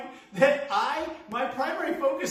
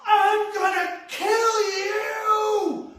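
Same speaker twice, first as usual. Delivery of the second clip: very high, slow, screamed threat; drawn-out maniac yell; crazed threat scream; 13 semitones higher, 6 dB louder, much slower than usual. Retold or imitated. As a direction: scream it like he's lunging at you. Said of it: "and goes".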